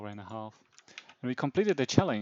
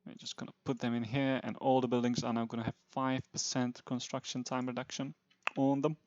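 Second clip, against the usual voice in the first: ordinary delivery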